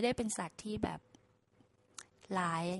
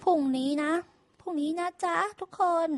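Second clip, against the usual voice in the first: higher, sing-song order to the workers; cheery farmer; 9 semitones higher, 5 dB louder than usual. Acted. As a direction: farmer brightly calling his hands. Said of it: "ไปสั่งลูกน้องเลยว่า".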